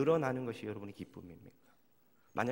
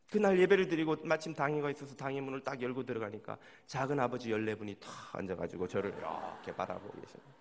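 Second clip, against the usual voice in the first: normal talking voice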